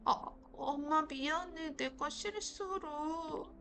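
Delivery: high, slow, whiny fake sob; fake crying. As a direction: play pitiful to soften mom. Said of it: "불쌍한 척을 해야 돼". (엄마 화 풀려고 일부러 우는 척)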